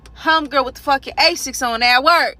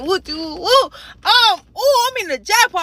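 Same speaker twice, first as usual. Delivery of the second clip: higher, louder whooping; retold excited client; 7 semitones higher, 6 dB louder than usual. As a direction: imitate him whooping over the jackpot. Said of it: "He like".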